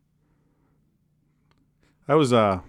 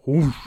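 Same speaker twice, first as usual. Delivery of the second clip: sudden whooshing burst; imitated whoosh; 6 semitones higher, 7 dB louder than usual. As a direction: make the rush of a big fish surging up. Imitating the grouper surging up.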